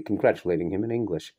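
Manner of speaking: plain reading voice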